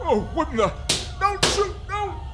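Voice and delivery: very high, panicked cry of don't shoot; terrified scream. Acted. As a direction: scream for your life. Man begs before being shot.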